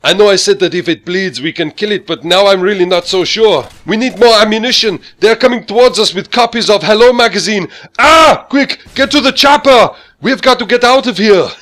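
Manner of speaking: a high, loud action-hero voice that climbs to a panicked yell; Arnold Schwarzenegger impression. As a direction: bellow it like a movie commando losing his nerve. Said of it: "I can just imagine Arnold Schwarzenegger in Iraq".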